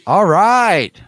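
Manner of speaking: high, loud all right; excited cheer. Real, not acted. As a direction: whoop it out. Hyped reaction.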